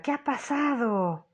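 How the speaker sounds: pregunta más aguda y lenta, alarmada; grito alarmado. A narrator's reading. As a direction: irrumpe gritando, asustada. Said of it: "Ruth llega corriendo y grita". (Ruth llega corriendo y grita alarmada)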